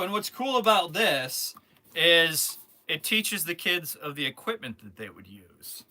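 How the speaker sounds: ordinary delivery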